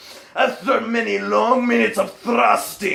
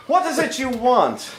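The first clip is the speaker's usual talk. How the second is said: a slightly higher, teasing lady; mildly higher voice of a mock-stern mistress; Integra voice; 4 semitones higher than usual.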